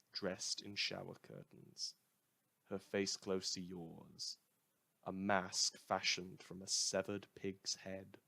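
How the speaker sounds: soft, hushed horror reading with slow lingering phrases; eerie story narration. Acted. Radio host reads a grisly story to listeners, hushed and slow. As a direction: read it low and quiet like a creeping nightmare.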